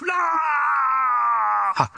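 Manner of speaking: high shouted cry, then slow demonic words; a demon screaming from a man. Retold or imitated. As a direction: rage at Jesus like a cornered demon. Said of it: "this guy goes".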